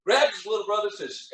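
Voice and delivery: ordinary talk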